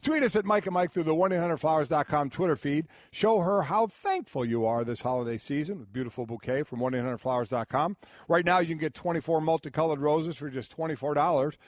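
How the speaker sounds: smooth sponsor ad read. Acted. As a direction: read it like a radio spot. Reads sponsor ad.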